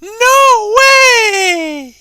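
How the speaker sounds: very high, loud mock-girly squeal; piercing falsetto, playfully mocking; mocking girl voice. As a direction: shriek it like a hammed-up girl, vowels held long. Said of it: "make a mocking voice".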